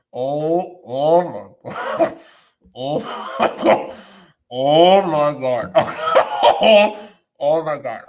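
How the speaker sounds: drawn-out oh my God through laughter; real laughing disbelief; slow laughing oh my God. Real, not acted. Reacting to documentary, laughing.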